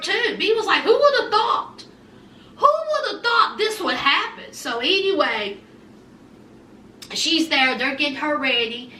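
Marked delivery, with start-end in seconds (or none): 0.8-4.5 imitating a torn-up reaction, pitched high; mock distraught. Retelling B's reaction to the breech news, mock distraught. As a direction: wail it in high-pitched disbelief, hammed-up. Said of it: "B's tore up".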